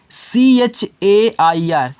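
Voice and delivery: सी एच ए आई आर one by one, slowly; slow spelling. Shown as a demonstration. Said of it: "इसको पढ़ते हैं". (धीरे धीरे spelling)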